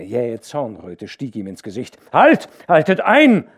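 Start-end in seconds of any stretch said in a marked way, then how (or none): 2.1-3.5 loud, high furious halt; angry shout. A narrator's reading. The angry dike reeve shouting down at the carts. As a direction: yell at the carts to stop now. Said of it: "schrie er zu den Karren hinunter".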